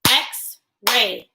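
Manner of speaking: "X-ray" in two separate beats; syllable demo of "X-ray"; broken into syllables. Shown as a demonstration. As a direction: pause between "X" and "ray". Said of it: "Clap with me".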